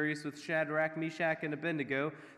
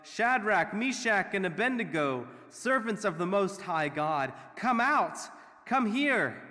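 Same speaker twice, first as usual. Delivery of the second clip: loud, high call to people some way off; shouted summons; 5 semitones higher, 6 dB louder than usual. A narrator's reading. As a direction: call them out forcefully, punching each phrase. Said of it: "and shouted".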